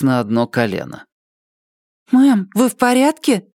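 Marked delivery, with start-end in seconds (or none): 2.1-3.5 high-pitched female character voice; anxious, raised-pitch question; concerned woman. Acted. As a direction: check on someone in a much higher voice. Mary checks on the fallen woman.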